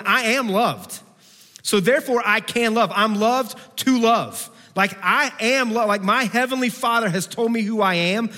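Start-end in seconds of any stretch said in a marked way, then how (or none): 0.0-4.5 bold affirmation. Shown as a demonstration. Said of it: "started going through our day going".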